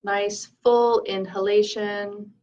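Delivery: calm meditation leader, leaving room to breathe; guided meditation. Acted. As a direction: lead them gently through the breath, unhurried.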